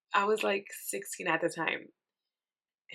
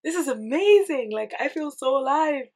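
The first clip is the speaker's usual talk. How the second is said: higher, amazed and elated; thrilled; 8 semitones higher, 6 dB louder, slower than usual. Retold or imitated. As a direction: sound giddy on the ride, voice lifted high. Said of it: "and you're like".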